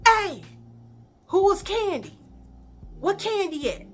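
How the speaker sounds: high-pitched mock holler; imitated calling out. People at the classroom door hunting Kandi, high mock hollering. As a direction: call through a door for someone.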